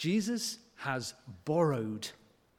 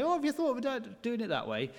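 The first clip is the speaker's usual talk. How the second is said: mock know-it-all; 9 semitones higher, faster than usual. Parent advising a teacher.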